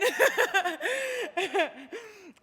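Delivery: high, crying laugh; choked-up laughter; teary laugh. Real, not acted. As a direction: laugh through real tears. Overcome after the rap.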